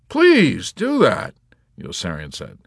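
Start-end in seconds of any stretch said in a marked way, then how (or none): 0.1-1.3 high, loud and drawn out; eager plea. Acted. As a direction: plead eagerly, stretching it out. Yossarian eagerly urging chaplain to return.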